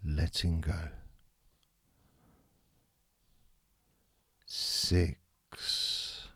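soft, fading meditation countdown; guided meditation. Acted. Leads a countdown relaxation.

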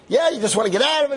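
loud, high, fast jeer; screaming accuser. Retold or imitated. Accuser dismissing the cyclist's denial, screaming. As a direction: throw it back at him, high and fast. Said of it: "He starts screaming at him".

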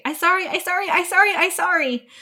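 put-on high, little-kid apology repeated; mock toddler voice saying sorry over and over; imitating a toddler. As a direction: squeak it out like a sorry three-year-old. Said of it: "I hear the three-year-old say".

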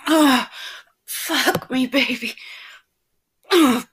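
loud, high, pleading cries; impassioned, pleading outcry; screaming with pleasure. Acted. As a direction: cry out high and loud, begging between moans. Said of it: "but now screaming with pleasure".

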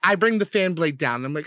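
ordinary talk, nothing marked

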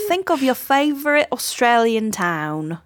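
put-on light Australian accent; a hint of Aussie twang. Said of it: "That's my Australian accent".

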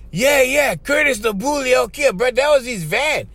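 high-pitched, eager yes; excited confirming. Retold or imitated. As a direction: imitate an excited kid confirming it. Kid excitedly confirms whose van.

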